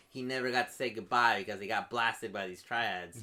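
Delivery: usual speaking voice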